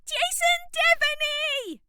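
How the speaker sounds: high, loud, slow bellowed name; top of her voice. A narrator's reading. Dolores bellowing the singer's name across the barrier, high and loud. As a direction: belt his name at the top of your voice. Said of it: "at the top of her voice".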